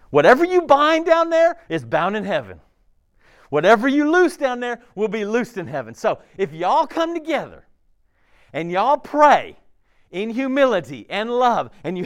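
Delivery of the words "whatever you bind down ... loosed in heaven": proclaiming Jesus. Acted. Quoting Jesus' proclamation with force.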